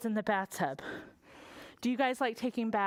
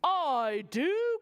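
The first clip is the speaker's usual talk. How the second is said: knight; 3 semitones higher than usual. Acted. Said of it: "cried the knight".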